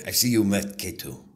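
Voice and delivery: lightly imitated accent of a co-star; a hint of someone else's accent; Diego. Actor retells a co-star's line, lightly imitated accent. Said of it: "I've been working on my Diego".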